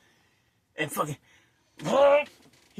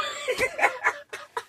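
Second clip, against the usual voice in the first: shouted laugh; 5 dB quieter than usual. Real, not acted. Laughing hard while acting out the head bumps. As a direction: burst out laughing.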